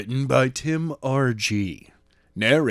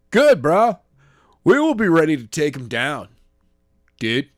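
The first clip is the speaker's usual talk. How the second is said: bro voice; 4 semitones higher, 5 dB louder than usual. Acted. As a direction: play the laid-back bro. Surfer-bro henchman voiced.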